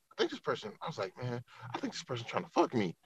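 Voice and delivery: retold private suspicion, kept under the voice; muttered inner thought. Man recalling his hunch that someone was hitting on him, muttered inner thought.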